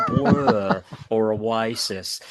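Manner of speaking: very slow, drawn-out celebrity voice, breaking into a laugh; mock impression of a drawling TV presenter; Lloyd Grossman impression. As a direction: stretch each word like a TV presenter. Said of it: "Sound like Lloyd Grossman".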